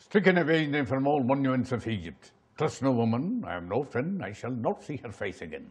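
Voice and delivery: low, slow, solemn film monologue; Ten Commandments film scene. Shown as a demonstration. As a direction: sink slowly into a quiet, final pronouncement.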